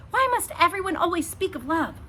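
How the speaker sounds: high, loud petulant complaint; bratty princess outburst. A narrator's reading. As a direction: stamp your foot as you say it. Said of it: "She stamped her foot".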